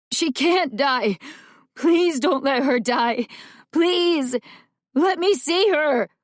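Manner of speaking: crying and pleading. A narrator's reading. Boy thrashing and crying, pleading.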